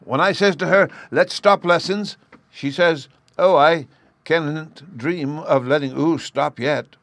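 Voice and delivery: put-on high, loud, slow child voice; a small boy complaining about his sister; high and loud childlike telling-on; little boy retelling. A narrator's reading. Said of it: "Bruno persisted".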